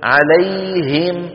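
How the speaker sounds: عليهم drawn out very slowly with a clean ه; slow عليهم, the ه pure; clear ha. Shown as a demonstration. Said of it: "فلا بد من بيانهما".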